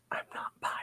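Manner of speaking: whispered aside, breathy and secret; hushed mock-defiant whisper; conspiratorial whisper. Sudden hushed aside to camera.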